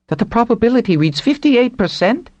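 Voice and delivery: high, eager rush of excitement; light voice lifting high with thrill; excited. A narrator's reading. Wanzer thrilled by the impact odds, excited high voice. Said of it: "Wanzer's light voice turned excited".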